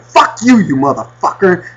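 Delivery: hammed-up swearing at an object; retold angry cursing. Cursing at the fan before throwing it, mock angry. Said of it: "you're cursing at it before you throw it".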